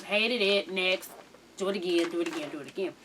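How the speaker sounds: low, soft, repetitive rejection; dismissive producer. Retold or imitated. Producer turning down a rapper's take, dismissive. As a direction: wave the take away in a low undertone.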